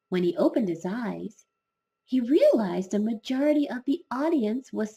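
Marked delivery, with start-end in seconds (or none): none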